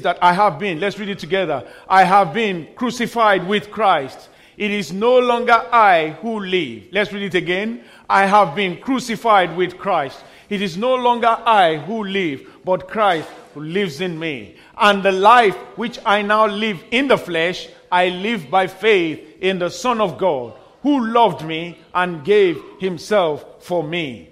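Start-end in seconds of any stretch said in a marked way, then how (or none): 1.9-6.8 loud, slow, measured group reading; scripture recitation. Acted. Pastor leading the congregation in reading together, slow declamation. 8.1-24.3 loud, slow, measured group reading; scripture recitation. Acted. Pastor leading the congregation in reading together, slow declamation.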